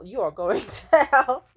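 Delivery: laughing. Real, not acted. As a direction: laugh through it. Laughing while condemning him.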